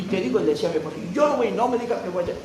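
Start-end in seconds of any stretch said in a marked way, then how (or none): 1.1-2.3 high-pitched mock protest; mildly angry, voice jumping up; retold indignant refusal. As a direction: act out a huffy refusal to the pastor. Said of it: "enojado en la iglesia".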